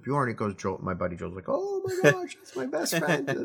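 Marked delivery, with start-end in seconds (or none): 1.5-3.4 high, excited squeal of surprise; excited recognition. Retold or imitated. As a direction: shoot way up in pitch, amazed at the coincidence. Buddy recognizes friend's brother.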